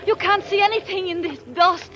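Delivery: high, alarmed exclamations in the storm; panicking about getting lost; alarmed cry. Acted. Woman caught in a dust storm, alarmed and high.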